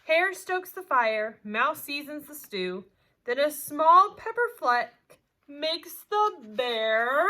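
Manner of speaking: lively storybook voice, big swings of pitch and loudness; animated read-aloud; reading to children. A narrator's reading. Teacher reads picture book aloud.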